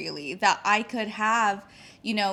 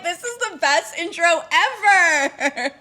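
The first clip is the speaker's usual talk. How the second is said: laughing; 7 semitones higher, 9 dB louder, slower than usual. Retold or imitated. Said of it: "You can tell I've been stalking you".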